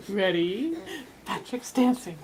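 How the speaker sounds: ordinary talk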